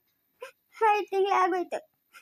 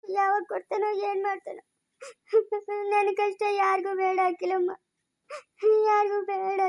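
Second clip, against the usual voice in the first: broken, sobbing lament; crying. Acted. Mother wishes her own mother were alive, crying. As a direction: lament through sobs with tearful gaps. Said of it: "ಅಳ್ಬೇಡ ಬಿಡು".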